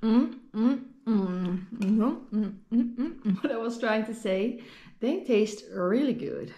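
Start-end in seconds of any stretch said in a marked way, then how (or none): none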